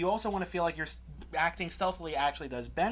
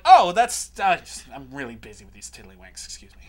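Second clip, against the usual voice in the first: lightly acted busy warden, loud "oh" dropping low; distracted warden; 6 semitones lower, 9 dB louder than usual. Warden brushing off a work order, distracted. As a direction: start loud, then mumble it away.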